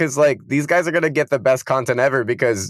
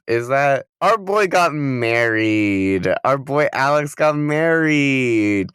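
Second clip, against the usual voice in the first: slow announcement; much slower than usual. Real, not acted. Announcing the wedding.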